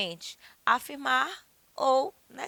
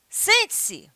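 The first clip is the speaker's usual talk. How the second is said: high, sharp loud order; commanding classroom order, pitched high; stern command; 10 semitones higher, 6 dB louder than usual. Shown as a demonstration. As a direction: order someone to sit down. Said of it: "Quando você diz assim".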